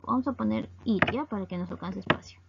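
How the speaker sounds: usual speaking voice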